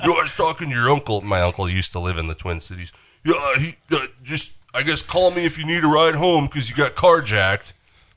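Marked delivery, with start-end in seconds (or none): 0.0-1.2 higher, quick dad voice; mock dad warning; imitating dad. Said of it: "you sound like my dad". 3.2-7.7 higher-pitched dad impression; put-on fretting father; imitating dad. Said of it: "you sound like my dad".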